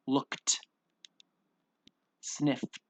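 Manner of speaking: "Looked" and "sniffed" ending in a puffed t; detached, airy t release on each word; breathed-out T ending. Shown as a demonstration. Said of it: "really breathe through that letter".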